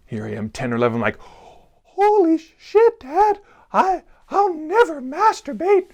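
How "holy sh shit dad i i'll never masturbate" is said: put-on very high, slow squeaky boy's panicked cry; a shocked little boy squealing in terror, close to tears; terrified child. Child terrified by father's warning.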